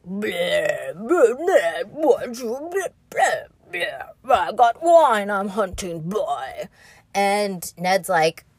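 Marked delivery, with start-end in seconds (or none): 0.1-6.7 put-on slurred, sloppy drunk king; drunk Robert. Mocking drunk King Robert slurring at Ned. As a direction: babble like a wine-soaked king. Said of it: "Robert's like".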